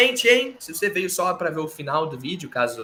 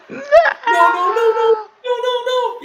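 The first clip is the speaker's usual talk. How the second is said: much higher, louder sobbing "no, no"; melodramatic Heyman crying; 9 dB louder than usual. Retold or imitated. Heyman crying as Punk attacks Roman, melodramatic. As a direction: wail no over and over, hammed-up. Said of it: "do jeito Paul Heyman de ser, melodramático".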